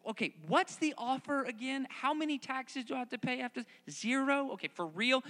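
put-on keen young David checking the deal; eager questioning. David asking the soldiers about the offer, eager and high. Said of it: "He's still asking people".

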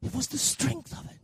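soft, breath-only near whisper; hushed emphasis. Real, not acted. Preacher repeating the point about Peter's prayer, hushed. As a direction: drop to a whisper to make it land.

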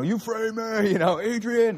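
Sylvester Stallone. Retold or imitated. Preacher imitating the actor confronting the warden, hammed-up impression. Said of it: "Stallone's saying".

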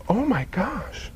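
slow, soft, stunned gasp of words; hushed amazement. Acted. Neighbor seeing the paraplegic running, hushed slow amazement. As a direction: breathe it slowly in soft disbelief.

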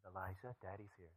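soft, fading comforting murmur; father hushing his feverish son, very soft; hushed soothing. Retold or imitated. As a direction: soothe a crying child back to sleep. Father calming his crying son.

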